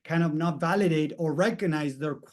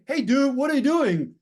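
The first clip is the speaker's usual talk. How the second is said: higher, mock-annoyed challenge to the dog owner; irritated call-out; 8 semitones higher, 3 dB louder than usual. To the guy whose dogs bullied hers, imitated angry call. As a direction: confront him, half-heated, in replay. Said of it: "I got pissed and say".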